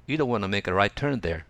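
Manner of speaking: "want to" merged into "wanna", said smoothly; relaxed linked "You don't wanna"; "wanna" reduction. Shown as a demonstration. Teacher modelling the natural spoken form. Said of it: "と言いますね".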